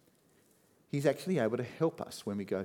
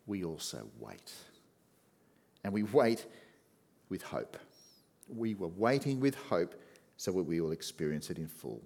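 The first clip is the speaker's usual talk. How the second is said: ordinary delivery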